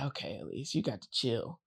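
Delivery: low, hushed talking to herself; quiet self-reproach. Acted. Elise telling herself to calm down, quiet self-reproach. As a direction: drop right down into a private aside.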